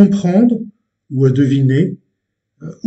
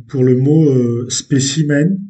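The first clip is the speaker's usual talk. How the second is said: ordinary talk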